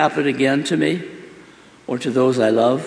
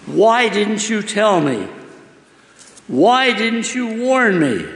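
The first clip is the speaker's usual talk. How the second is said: higher, slow desperate questions to God; anguished plea; 8 semitones higher, slower than usual. Acted. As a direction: beg God for an answer, hurt. Boy screaming silently at God.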